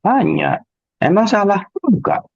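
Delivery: high, emphatic rhetorical answers. Acted. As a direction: answer your own question brightly.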